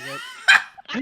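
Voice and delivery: very high, slow, distorted voice; helium voice. Real, not acted. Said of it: "Why did he sound like he was on helium".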